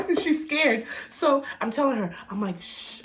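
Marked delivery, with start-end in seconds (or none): 2.6-3.0 soft shush. Retold or imitated. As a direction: hush the child. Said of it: "You have to be quiet".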